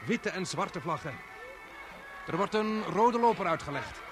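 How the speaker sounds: eager reporter calling the scene as it unfolds; live commentary. Acted. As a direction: talk to the viewers like a live broadcast. Live reporter describing the procession. (enthousiaste live-verslaggever)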